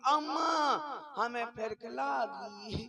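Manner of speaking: put-on whimpering child's plea, dragged out slowly; crying child. Little boy whimpers to his mother on the road, slow and teary. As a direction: sob it out like a small boy who can't hold it.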